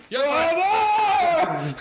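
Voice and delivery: high hammed-up voiceover "Yes"; a laughing go at a booming announcer; Matt Berry yes. Host quotes the character's famous reply, laughing. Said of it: "except for".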